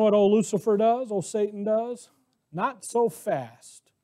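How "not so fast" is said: Satan's retort. Acted.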